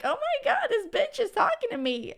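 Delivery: squealing high, imitating a thrilled fan; mock excited listener. Host imitating a listener who feels spoken to, high mock squeal. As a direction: play the stunned listener, pitch way up. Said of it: "Are you like".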